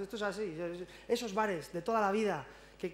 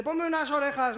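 loud, higher shouted order; 5 semitones higher, 5 dB louder than usual. Retold or imitated. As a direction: call out the order over the noise.

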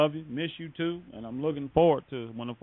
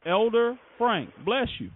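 high, drawn-out, happy welcome; delighted greeting; 8 semitones higher, slower than usual. Real, not acted. Host welcoming a fellow elder on air, delighted.

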